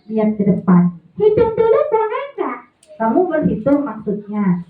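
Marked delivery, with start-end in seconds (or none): 1.2-2.6 high ventriloquist puppet voice; higher puppet asking to count. Acted. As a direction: ask eagerly like a little puppet.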